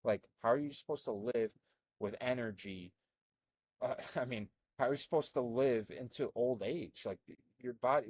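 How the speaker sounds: ordinary talk, nothing marked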